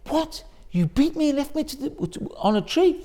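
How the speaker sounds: high, incredulous outrage; mock disbelief at the nerve; indignant what. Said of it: "instead of saying".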